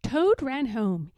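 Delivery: usual speaking voice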